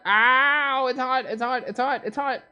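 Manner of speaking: yelping at the heat; high pained yelps, it's hot; burnt fingertips. Real, not acted. Burning himself on something hot.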